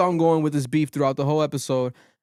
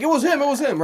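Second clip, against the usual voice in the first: mock accuser; 11 semitones higher, 4 dB louder than usual. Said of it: "They're like".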